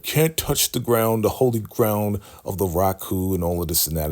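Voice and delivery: normal talking voice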